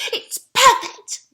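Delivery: very high, loud, slow "It's perfect"; squeaky pig. Acted. Boris answering Percy, very high squeal. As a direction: squeal it proudly. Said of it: "Boris said".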